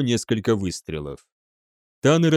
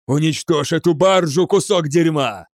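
higher, louder angry shout; furious order; 5 semitones higher, 4 dB louder than usual. A narrator's reading. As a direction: hurl the order and insult. Commander hits officer, orders attack. (яростно орёт)

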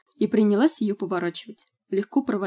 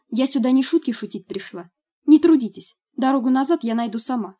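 indignant, raised voice; angry; 5 semitones higher, 5 dB louder than usual. Acted. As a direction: snap back, offended and proud. Offended girl storms out.

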